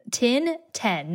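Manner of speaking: "tin" and "ten" with clearly different vowels; distinct tin and ten. Shown as a demonstration. Showing they're very different, "tin", "ten" kept apart. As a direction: separate "tin" from "ten", slowly. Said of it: "they're very different".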